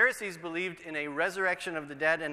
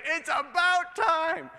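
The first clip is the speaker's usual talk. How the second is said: slow, high, drawn-out it's about time; mock exasperation; 10 semitones higher, 5 dB louder, slower than usual. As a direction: roll your eyes at the wait.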